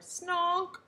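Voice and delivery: very loud, high roar. A narrator's reading. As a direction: bellow it at the top of your voice. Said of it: "the king roared".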